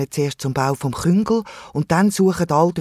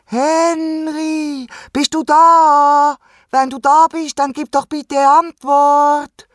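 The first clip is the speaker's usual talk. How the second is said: high, loud, slow calling; shouting; 13 semitones higher, 7 dB louder, much slower than usual. A narrator's reading. Animals shout into the cave for Henry, calling loudly. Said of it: "Von dem Geschrei verwacht".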